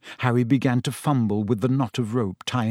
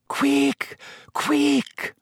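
high, thin urgent moan; anxious moan; 13 semitones higher than usual. A narrator's reading. Hermione hurries her friends along, moaning anxiously. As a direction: moan it in high-pitched haste. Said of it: "Hermione moaned".

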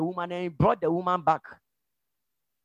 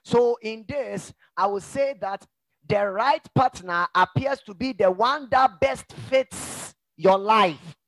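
ordinary talk

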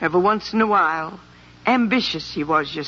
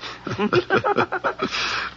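high chuckle; 11 semitones higher, 3 dB quieter than usual. Real, not acted. Laughing at the bear story.

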